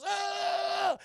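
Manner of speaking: high loud battle scream; sharp shrieking attack yell. Retold or imitated. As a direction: let out a high war cry. Charlie bursts out sawing.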